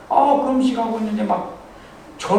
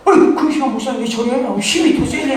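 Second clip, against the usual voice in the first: mocking jeer; 5 semitones higher, 5 dB louder than usual. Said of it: "막 소리를 질러고".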